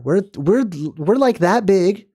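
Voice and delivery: ordinary talk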